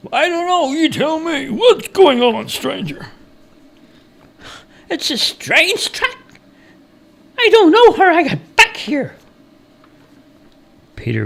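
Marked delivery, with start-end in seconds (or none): 0.1-9.1 very high-pitched lost traveller; bewildered stranger. A narrator's reading. Stranger baffled to be back at the cabin, bewildered character voice.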